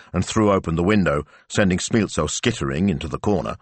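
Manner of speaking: usual speaking voice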